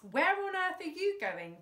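demanded. A narrator's reading. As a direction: insist on an answer. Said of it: "demanded Fingers".

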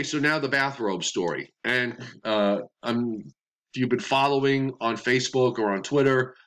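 usual speaking voice

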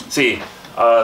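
ordinary talk

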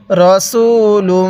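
রসুলুম read very slowly, the final ম hummed through the nose; nasal ghunnah demo. Shown as a demonstration. Quran teacher to students, slow nasal ghunnah demo. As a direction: stretch রসুলুম and let the ম ring nasally. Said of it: "অবশ্যই নাকের বাসি দ্বারা গুনাহটা করব".

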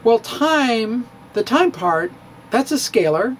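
ordinary talk, nothing marked

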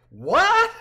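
very high loud what; astonished exclaim. A narrator's reading. Friend exclaiming in disbelief at tired Gene. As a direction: cry out in disbelief. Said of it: "Rachel exclaimed with a laugh".

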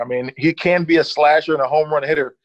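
ordinary talk

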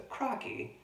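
high-pitched amateur Australian catchphrase; attempted Australian accent. Shown as a demonstration. Reader showing children an Australian voice, playful amateur accent. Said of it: "I'm trying to do my Australian voice".

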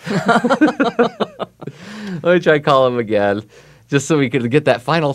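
slow, laughing through the words. Real, not acted. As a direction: chuckle as you say it. Laughing while redialing guest.

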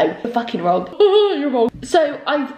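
normal talking voice

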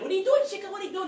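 high, pushy protest; adamant. Retold or imitated. Classmate protecting her report time, adamant and high. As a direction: defend your spotlight. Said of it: "really adamant".

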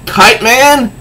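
high incredulous question; disbelieving Riddler. Acted. Riddler disgusted at losing to Kite Man, high. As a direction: spit out the name in disbelief. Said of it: "the Riddler says".

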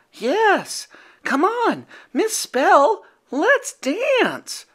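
eager little monster; high childlike Yeti. A narrator's reading. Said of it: "said Furball".

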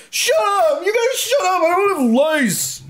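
high crying shout; crying yell. Acted. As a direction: scream it near tears. Kid screams denial.

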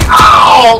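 sudden loud "ow"; loud yell of ow. Real, not acted. Gamer hit mid-story, loud ow. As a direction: yelp it out, cutting your own sentence.

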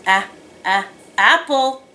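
"a-, a-, apple", short first vowel repeated, then the word; sounding out apple. Shown as a demonstration. Teacher giving children a first-sound clue, sounding out "a-, a-, apple". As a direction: give the opening vowel twice before "apple".

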